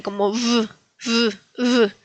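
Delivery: "v" as a short vibrating buzz; vibrating V sound. Shown as a demonstration. Teacher shows learners the Polish W, vibrating V. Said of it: "es como una B más vibrante".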